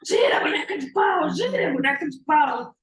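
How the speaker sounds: high, loud cry; frenzied storybook yell, pitched well up; acted mad shouting. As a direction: shout it like a madman in a fit. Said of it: "Começa a gritar novamente".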